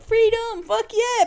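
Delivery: a high, loud hammed-up freedom cry; mock patriotic cheer. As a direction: belt it like a parody American. Celebrating freedom mockingly.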